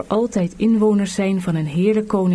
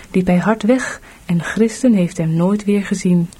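usual speaking voice

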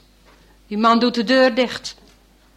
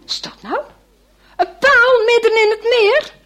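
surprised; 11 semitones higher, 6 dB louder than usual. A narrator's reading. Astonished question to listeners.

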